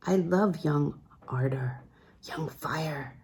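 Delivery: slow tender whisper. Acted. Sebastian confessing his love to Lucy, hushed whisper. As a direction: breathe it out as a private confession.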